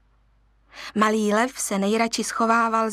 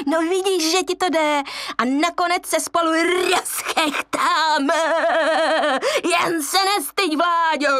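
high put-on hyena voice, coaxing; 7 semitones higher than usual. Hyena encouraging Vláďo to cackle, high coaxing voice. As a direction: egg him on in a high hyena voice.

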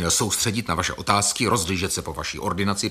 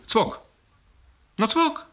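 high indignant rise on cvok; outraged patient repeating cvok; 12 semitones higher, slower than usual. Acted. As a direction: spit out the word cvok, offended. Patient outraged at being called mad.

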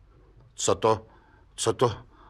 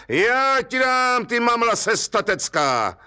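higher, slow mock threat; threatening call; 11 semitones higher than usual. Stranger threatens Pudil across the river, hammed-up threat. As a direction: drag it out as a warning. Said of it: "ten člověk na druhém břehu povídá".

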